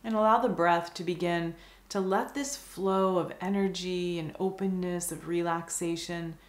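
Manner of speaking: calm meditation guide, long pauses between phrases; guided meditation. Acted. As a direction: guide the listener gently into stillness, unhurried and even.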